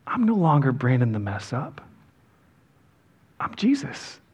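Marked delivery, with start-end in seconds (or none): 3.4-4.2 whispering. Real, not acted. Hushed sermon emphasis.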